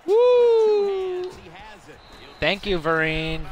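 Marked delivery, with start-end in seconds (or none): 0.1-1.3 high, loud falsetto woo; excited whoop. Real, not acted. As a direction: celebrate with a high cheer. Celebrating a play.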